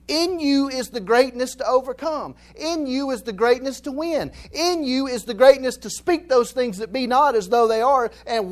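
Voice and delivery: fired-up sermon refrain; preaching at full force. Real, not acted. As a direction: build it like a revival sermon.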